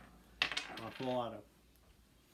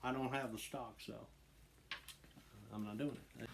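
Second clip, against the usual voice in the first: normal talking voice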